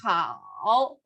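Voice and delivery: Hao dipping low, then rising; third tone. Shown as a demonstration. Teacher showing third tone, Hao low and dipping. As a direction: sink low on "Hao" and curl back up. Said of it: "Con el tercer tono".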